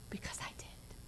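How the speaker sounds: hushed, breathless admission; whispered confession. Real, not acted. Preacher admitting her own past, whispered. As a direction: let it slip out in a whisper.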